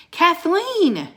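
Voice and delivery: a loud high call of the name. Acted. Teddy calling Kathleen out from behind the ice, calling loudly. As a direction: call her out from hiding. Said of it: "he called".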